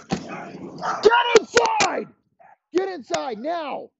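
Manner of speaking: yelling at dogs. Real, not acted. Yelling out at the barking dogs behind him, real furious shout. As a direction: bellow it out so they run inside. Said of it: "listen to me fucking yell at these dogs".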